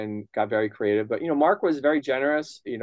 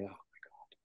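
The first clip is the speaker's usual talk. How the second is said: breathless whispered oh my God; a hushed, intimidated whisper; 4 semitones lower, 27 dB quieter than usual. Retold or imitated. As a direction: whisper it in stunned disbelief. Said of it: "I'm like".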